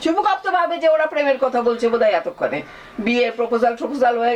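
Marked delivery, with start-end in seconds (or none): none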